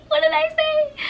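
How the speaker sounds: very high squeaky mock panic; high-pitched squeal. Girl just accused by her best friend, panicked squeal. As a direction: squeal the question, far above normal voice. Acts out being confronted, two takes.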